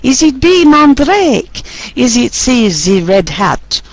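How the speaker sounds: mock French accent, playful and laughing; dodgy French accent. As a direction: quiz options read in a hammed-up French accent. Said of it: "she gets to do a dodgy French accent for all the options".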